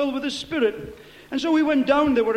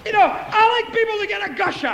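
high, loud exclamation; raised-pitch pulpit cry; rousing preacher shout; 6 semitones higher, 5 dB louder than usual. Real, not acted. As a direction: proclaim it to stir the congregation. Rallies congregation against feeble tongues.

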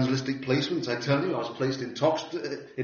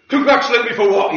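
higher, louder, irritated retort; a little angry; 8 semitones higher, 12 dB louder than usual. Retold or imitated. Annoyed man poking his nose round the door, irritated retort. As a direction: bristle at the odd compliment. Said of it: "Get a little angry".

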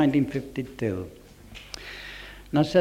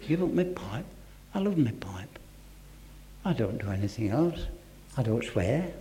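softly protesting; 3 semitones lower, 6 dB quieter than usual. Retold or imitated. Reasoning with himself.

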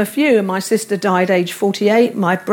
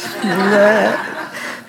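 chuckle; 2 semitones lower than usual. Real, not acted. Laughs after punchline.

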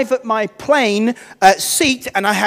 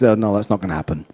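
much lower, quick, dismissive clerk; gruff refusal; 13 semitones lower, 5 dB quieter, faster than usual. Retold or imitated. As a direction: brush him off like a swamped official. Stressed clerk refuses the upgrade.

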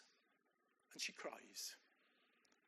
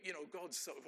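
normal talking voice

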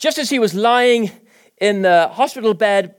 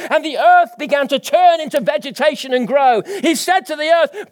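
full-force preaching; 7 semitones higher than usual. Acted. Preacher stirring the congregation, full-force preaching. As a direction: preach it out with conviction.

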